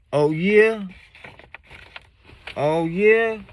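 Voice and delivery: high, loud mocking drunk voice; drunk guy. Drunk stranger taunting the teller, high and loud. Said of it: "I could tell they drunk, slurring their words".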